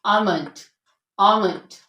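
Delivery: almond said as amond, no l; silent l. Shown as a demonstration. Teacher corrects the common mistake, l silent. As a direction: drop the l in almond. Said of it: "एल को silent रखते हैं".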